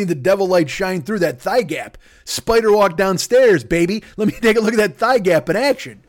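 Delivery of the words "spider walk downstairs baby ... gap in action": excited leer. Acted. Leering at the Exorcist girl, loud excited. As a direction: egg her on gleefully.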